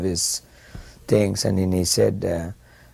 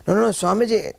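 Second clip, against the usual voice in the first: much higher, pleading refusal; protesting again; 13 semitones higher than usual. Retold or imitated. Businessman to Prabhupada, pleading refusal, pitched very high. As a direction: plead your way out of it.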